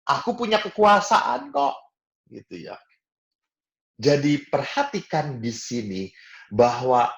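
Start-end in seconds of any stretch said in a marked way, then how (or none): 0.0-1.8 much higher, loud boastful parent voice; a parent insisting on their power; proud parent. Retold or imitated. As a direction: claim your authority like a self-important parent. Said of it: "Karena merasa apa?".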